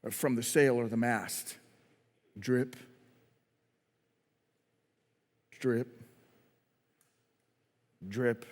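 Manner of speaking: very soft, low, with long pauses; hushed awe. Acted. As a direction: let the storm fall away to near silence. Hushed awe after storm stops.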